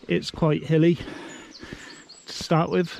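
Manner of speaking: breathless from the uphill climb; panting slightly while walking; out of breath. Real, not acted. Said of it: "I'm already out of breath".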